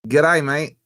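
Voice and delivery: hamming up an Aussie TV producer's greeting; mock Aussie caller. Retelling an Australian caller's phone greeting, mock Aussie accent.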